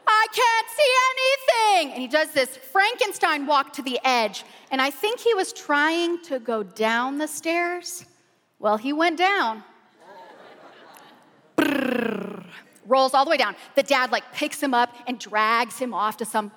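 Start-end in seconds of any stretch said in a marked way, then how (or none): 0.1-1.9 high, loud, slow dazed yell; shouting groomsman. Retold or imitated. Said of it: "And he's going". 11.6-12.4 tumbling noise. Retold or imitated. Groomsman tumbles down stairs.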